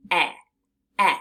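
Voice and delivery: "e" said as a short eh; short e sound. Shown as a demonstration. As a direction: model the short eh twice. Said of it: "but we hear the sound".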